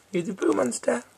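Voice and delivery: squeaky high voice to a pet. Real, not acted. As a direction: coo it way up high. Baby talk to the dog.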